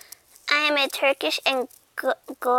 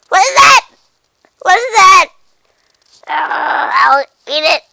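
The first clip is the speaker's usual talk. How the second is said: startled squeal; 4 semitones higher, 16 dB louder than usual. Pretend. Cat startled by something.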